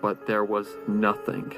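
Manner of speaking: usual speaking voice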